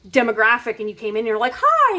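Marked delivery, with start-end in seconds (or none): 1.6-2.0 very high, bright-eyed greeting; squeaky innocent hi; naive chirpy hi. Retold or imitated. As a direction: chirp a clueless hello.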